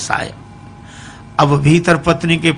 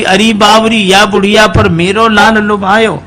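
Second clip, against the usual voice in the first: scolding mother-in-law; 4 semitones higher than usual. Acted.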